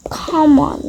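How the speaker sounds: ordinary delivery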